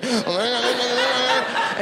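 freaking out harder. Retold or imitated. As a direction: babble until the words melt together. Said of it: "I start freaking out".

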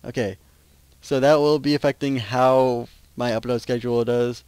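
ordinary delivery

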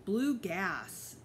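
usual speaking voice

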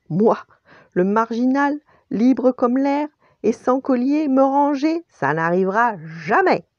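high, loud, mock-outraged refusal; protest, building high before a firm ending; indignant protest. A narrator's reading. Stray dog scorns settling down, indignantly loud.

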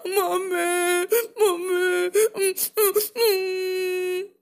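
put-on whimpering child, drawn-out and tearful; acted crying. Lost little bear calls for mummy, mock crying. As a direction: sob for mother like a lost little one. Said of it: "Don't cry".

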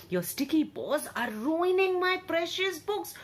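high, put-on cross voice; grouchy old bear. Bookseller scolding cubs about his books, high grumpy voice. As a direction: fuss over the books like a cranky shopkeeper.

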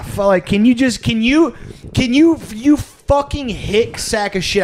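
frustrated patient venting at the doctor; exasperated rant. Retold or imitated. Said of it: "Like, ah".

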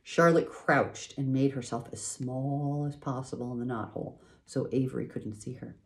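plain reading voice

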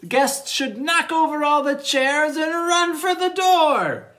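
high, building rush of narration; mock excitement. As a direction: build excitedly toward a wild dash. Guests rushing out, acted excitedly.

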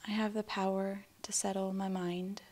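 calm, unhurried affirmation with long silences after; spoken affirmations. Acted. Guide giving affirmations for listeners to repeat, calm meditation voice. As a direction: say each statement gently, leaving room to repeat it.

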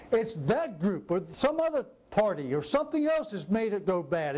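normal talking voice

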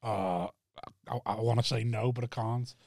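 low, soft hesitant mock reluctance; reluctant refusal. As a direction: mumble it like you hate saying no. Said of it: "you've gone".

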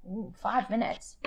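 plain reading voice

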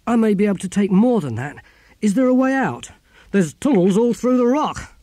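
high, loud, pressing exchange; urgent planning. Acted. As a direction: press for an escape route. Urgent escape planning in burning library.

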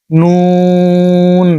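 নুন held for about four seconds; drawn-out Arabic letter. Shown as a demonstration. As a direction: stretch নুন long. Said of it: "চার second টান দিবেন". (নুন চার সেকেন্ড টেনে)

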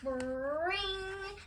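high, drawn-out "ring"; imitated phone ring. A narrator's reading. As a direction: sing out a long telephone ring. Imitates the ringing telephone.

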